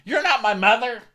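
high, loud imitated retort; mock indignant snap. Host imitating Jenny snapping at Lisa, high and loud. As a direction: fire it back hammed up.

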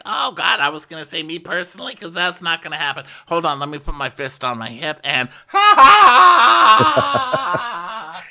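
high shrieking fake laugh; mock Denise laugh. Host mocking Denise laughing at herself, shrieking high laugh. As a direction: hand on hip, shriek the ha ha up high. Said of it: "She's like".

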